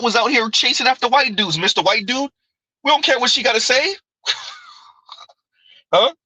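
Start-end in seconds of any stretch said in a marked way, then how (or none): none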